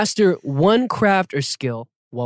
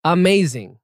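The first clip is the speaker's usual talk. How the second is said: excited; 5 semitones higher, 5 dB louder than usual. Real, not acted. Host gushing after hearing the song.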